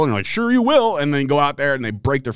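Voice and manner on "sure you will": high, mocking sarcasm; sarcastic. Promoter answering a rookie who says he'll wrestle, sarcastic. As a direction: brush off his boast with disbelief.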